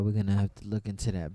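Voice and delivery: ordinary delivery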